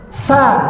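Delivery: high, clear "sa"; clear model syllable. Shown as a demonstration. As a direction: say "sa" crisply as the model. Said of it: "Kalau anak saya begini".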